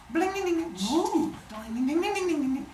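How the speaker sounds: high, ringing ding-ding notes; quick tinkling lyre noises with an impressed ooh; imitated strumming. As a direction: voice the high strings being plucked. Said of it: "he starts to strum it".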